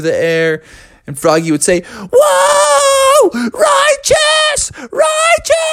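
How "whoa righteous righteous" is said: very high, excited frog squeal; thrilled whoop. Acted. Froggy carried through the air by Eddie, high thrilled whooping. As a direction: whoop in a high, far-from-your-own voice.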